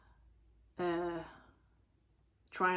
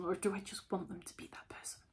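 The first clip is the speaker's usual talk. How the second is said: unvoiced whisper of self-doubt; 8 dB quieter than usual. Reader voicing a listener's private doubt, whispered. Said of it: "take a step back and go".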